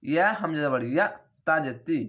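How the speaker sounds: normal talking voice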